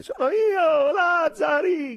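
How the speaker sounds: very high, loud sobbing call; crying shout. Acted. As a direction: shout through tears, slow.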